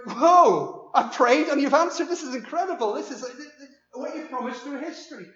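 loud, high burst of astonished joy; imagined awestruck reply, bursting out then stammering; acted amazement. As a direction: blurt it out like a prayer just answered. Acts out Zechariah's hoped-for amazed reaction.

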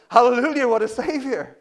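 loud, high hallelujah; crying out in praise. Acted. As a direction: proclaim it in exultant praise. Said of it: "how can we not cry out".

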